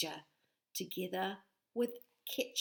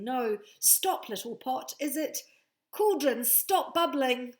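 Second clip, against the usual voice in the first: louder, higher command; commanding the cauldron; 5 semitones higher, 8 dB louder than usual. Acted. Betty trying to stop the cauldron, loud command. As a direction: order the pot to stop.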